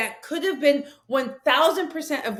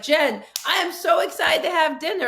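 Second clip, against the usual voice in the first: mock excited friend; 7 semitones higher, 5 dB louder than usual. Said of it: "you're like".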